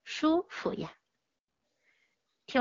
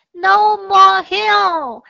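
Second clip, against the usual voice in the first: put-on high, loud, slow voice of a child; drawn-out, high, loud complaint; tired kids' cry; 8 semitones higher, 8 dB louder, much slower than usual. Jack and Jill refuse to climb, high, loud, slow.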